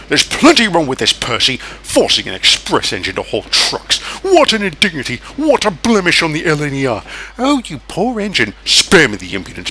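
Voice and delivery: lightly voiced proud express engine; pompous Gordon. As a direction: grumble about the indignity. Gordon grumbles to Percy.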